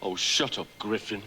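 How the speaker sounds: snapping. Acted. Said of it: "Snapped the Mock Turtle".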